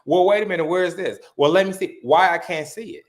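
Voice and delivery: ordinary talk, nothing marked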